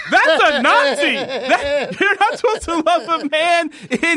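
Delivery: high-pitched, laughing indignation; scandalized and amused; incredulous outrage. Real, not acted. Outraged at the quoted line.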